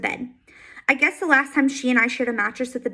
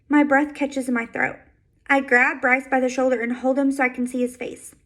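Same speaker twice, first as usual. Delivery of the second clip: ordinary delivery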